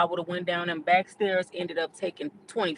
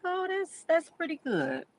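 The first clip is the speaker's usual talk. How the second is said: high, delighted "Oh, that's pretty good"; pleasantly surprised; 11 semitones higher than usual. Retold or imitated. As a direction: react with happy surprise to good news. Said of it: "I'm like".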